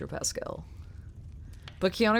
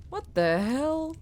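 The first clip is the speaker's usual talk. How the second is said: high, slow startled what the hell; 6 semitones higher, much slower than usual. Real, not acted. Streamer startled by the creepy game sound, slow and high. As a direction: caught off guard by a creepy noise.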